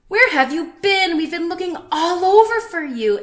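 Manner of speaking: high, loud happy greeting; excited friends. A narrator's reading. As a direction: welcome him back eagerly.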